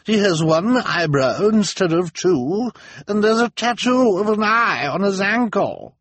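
high, busybody voice; Missus Morrow. A narrator's reading. Missus Morrow accusing the prisoner, high and eager. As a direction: point it out eagerly. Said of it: "Missus Morrow called".

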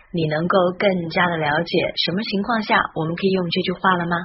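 ordinary talk, nothing marked

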